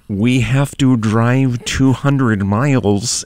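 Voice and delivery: slow, stretched fake American accent mocking an actress; bad American accent. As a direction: drag out a clumsy American accent. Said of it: "she cannot do an American accent".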